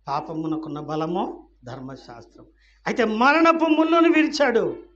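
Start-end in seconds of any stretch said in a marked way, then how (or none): 2.9-4.8 loud, high preacher's shout; raised pulpit cry; triumphant proclamation. Real, not acted. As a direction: proclaim the victory at full voice. Preacher proclaims, then repeats plainly.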